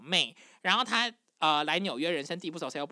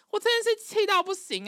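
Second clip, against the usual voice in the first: high-pitched, worked-up venting; exasperated; 4 dB louder than usual. Real, not acted. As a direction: squeal it out in sheer exasperation. (气到不行地尖声抱怨)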